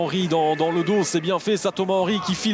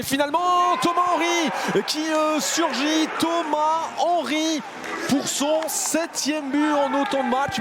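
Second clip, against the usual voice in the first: goal yell; 5 semitones higher than usual. Real, not acted. Goal call in live commentary.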